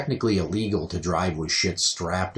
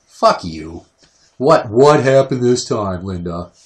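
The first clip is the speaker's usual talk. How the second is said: slow, louder, exasperated mock retort; retold snide reaction; 6 dB louder, slower than usual. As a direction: throw it back at mom, fed up and slow. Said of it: "Here's my reaction".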